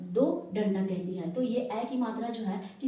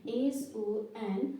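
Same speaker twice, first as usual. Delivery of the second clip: each letter stretched out slowly; drawn-out dictation of the letters; slow spelling; much slower than usual. Shown as a demonstration. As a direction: spell it slowly for the class to copy. Teacher spells letters for class.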